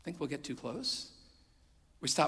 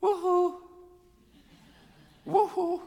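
put-on high, loud playful "woohoo" of a hiding boy; child's gleeful call; 9 dB louder, slower than usual. Father imitates his little son calling from his hiding spot, high and loud. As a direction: call out like an excited preschooler giving himself away. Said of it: "and he'd go".

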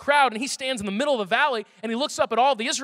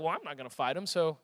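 much lower and softer, a shrugging aside; mumbled excuse; 8 semitones lower, 9 dB quieter than usual. Retold or imitated. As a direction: mutter it like a coward dodging. Soldiers shrugging off the fight.

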